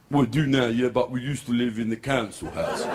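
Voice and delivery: lightly voiced boxer impression; Frank Bruno. Boxer replies about his detached retina, light impression. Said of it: "I love Frank Bruno".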